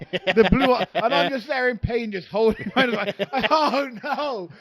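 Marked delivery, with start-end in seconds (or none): 3.5-4.5 high mock wail through laughter; pained wail. Retells his pain, mock wail.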